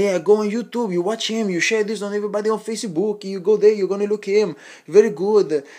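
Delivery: imitated thick Brazilian accent, pitched high; mock a self-promoting Brazilian braggart; Renato Laranja. Telling someone to watch him on YouTube, mock Brazilian accent. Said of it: "he talks with this Brazilian accent, really thick".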